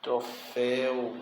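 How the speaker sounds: "troféu" very slowly, split into syllables; low, soft, stretched "troféu"; drawn-out example. Shown as a demonstration. Teacher showing the class an accented ending, "troféu" slow and drawn out. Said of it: "por exemplo".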